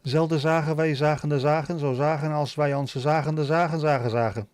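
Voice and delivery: continuous run of guttural Dutch; Dutch tongue twister. Shown as a demonstration. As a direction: reel off the Dutch in one breath. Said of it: "Dutch tongue, tongue twisters".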